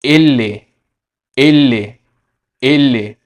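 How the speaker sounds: एले as Spanish "ele"; Spanish letter name. Shown as a demonstration. Teacher to students, careful Spanish letter name. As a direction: model एले slowly. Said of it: "एल का pronunciation".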